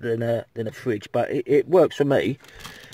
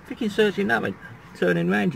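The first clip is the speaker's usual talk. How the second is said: much higher, scornful outburst; mock indignation; 9 semitones higher than usual. Real, not acted. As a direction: scoff at the silly question. Mocking the bloke's question.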